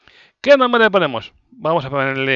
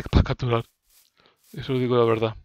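ordinary delivery

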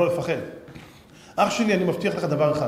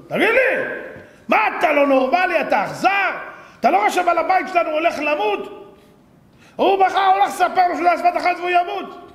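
very high, loud outraged shouting; indignant scolding; 13 semitones higher, 5 dB louder than usual. Acted. Dog scolding the singing rooster while master faces death, indignant. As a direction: berate him as heartless, at full voice.

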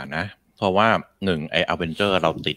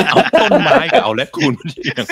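words pushed out high and louder through laughter; laughing while talking; 8 semitones higher, 5 dB louder than usual. Real, not acted. Laughing at friend's argument. (พูดไปหัวเราะไปเสียงสูงดังขึ้น)

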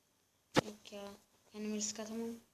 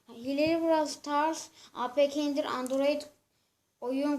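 slow, louder spelling-out of the search words; loud dictation; 3 semitones higher, 8 dB louder, slower than usual. Real, not acted. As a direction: call out each search word clearly. Dictating the search text aloud.

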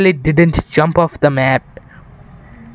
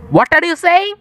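squeaky, much higher disbelief; incredulous; 11 semitones higher than usual. Real, not acted. Player stunned by teammate's remark, high incredulous squeak. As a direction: shoot way up in disbelief.

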